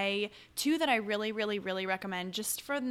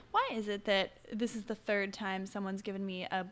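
ordinary talk

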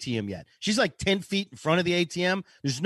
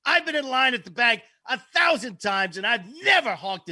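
indignant lecture; 5 semitones higher than usual. Retold or imitated. Old woman lecturing him about honking, mock indignant. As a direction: imitate an outraged scolding, voice raised. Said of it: "she starts to lecture me".

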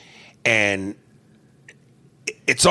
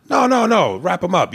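mock insistence; 9 semitones higher, 3 dB louder than usual. [name] insisting.

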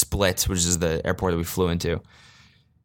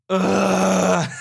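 frustrated groan; 9 semitones higher than usual. Real, not acted. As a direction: let out a fed-up groan. Fed up with bumper.

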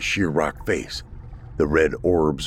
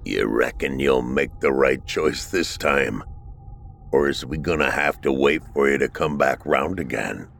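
put-on lower, dry old man's voice; Thomas's voice; 6 semitones lower than usual. A narrator's reading. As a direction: toss it off like it's obvious. Thomas voiced, low old man.

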